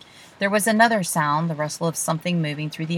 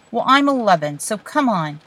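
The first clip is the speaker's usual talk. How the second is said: exasperated; 4 semitones higher, 5 dB louder than usual. Acted. Quarrel between children.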